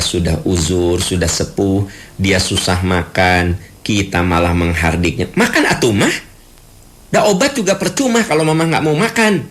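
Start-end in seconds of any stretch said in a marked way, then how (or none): 5.4-9.5 high, exasperated prodding; impatient nagging. Acted. Child pressing sick mother to eat, high impatient nag. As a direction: hurry her to eat, fed up.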